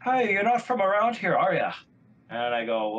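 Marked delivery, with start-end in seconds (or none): 0.0-1.7 higher, friendly local's voice; Irish pub guy. Retold or imitated. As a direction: play the curious stranger at the bar. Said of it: "he looks at me and he goes".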